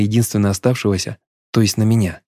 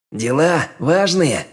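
slow, higher sneering doubt; sneering question; 6 semitones higher, much slower than usual. A narrator's reading. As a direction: drawl it with a crooked smirk. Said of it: "Он криво усмехнулся".

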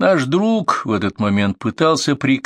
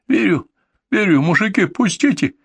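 frightened begging; 11 semitones higher than usual. A narrator's reading. Pinned man begs to be released.

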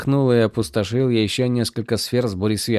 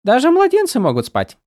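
high, light and teasing; a tiny sprite needling her friend; playful spren voice; 14 semitones higher, 6 dB louder than usual. A narrator's reading. Голос Сил. (высокий игривый голос духа)

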